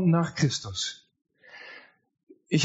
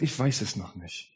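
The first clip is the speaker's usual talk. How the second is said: hesitant doubter; 6 semitones lower, 6 dB quieter than usual. Retold or imitated. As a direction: lower and softer, unsure. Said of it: "manch anderer sagt". (zögernd, leiser und tiefer)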